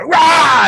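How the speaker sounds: very high, loud attack roar; acted war cry on the lunge; aggressive battle cry. Trainee lunging at instructor with rifle, aggressive yell.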